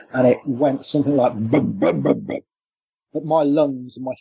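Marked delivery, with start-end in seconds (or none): 1.3-2.4 low booming bum-bum mimicking a creature heard at night; imitated deep guttural call. Guest mimics the night creature's call for the host, low and guttural. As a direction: thump out the creature's call, deep as you can. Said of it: "deep, guttural vocalization".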